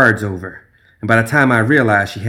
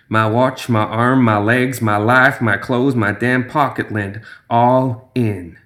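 ordinary delivery, nothing marked

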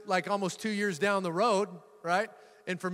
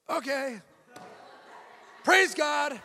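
forced cheer; 8 semitones higher, slower than usual. Retold or imitated. Forced cheer after car falls through.

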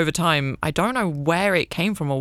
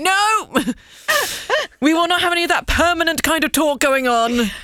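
mock indignant; 12 semitones higher than usual. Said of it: "Be like".

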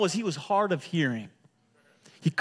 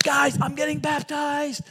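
excited announcement; 10 semitones higher, 3 dB louder, slower than usual. Retold or imitated. Excited new believer quoted.

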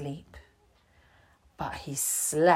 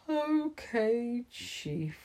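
soft, deflated little reply; very quietly; 5 semitones higher, 7 dB quieter, much slower than usual. Acted. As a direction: mumble it, beaten and ashamed. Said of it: "said very quietly".

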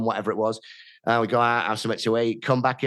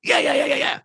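much higher, rapid eager yeses. Retold or imitated. Mate agreeing to Amsterdam, eager and high. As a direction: gush agreement like a keen mate. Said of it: "And he's like".

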